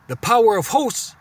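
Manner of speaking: slow proclaiming of the verse; scripture reading. Acted. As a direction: draw out the verse with weight.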